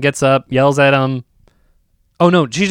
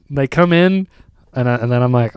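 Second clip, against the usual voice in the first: ordinary delivery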